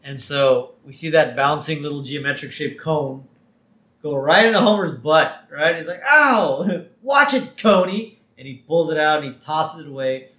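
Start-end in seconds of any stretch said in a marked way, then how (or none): 6.1-8.2 high, loud mock yelp; cartoon yelp of pain, then indignant; Homer. Host as Homer poked by a cone, mock-indignant.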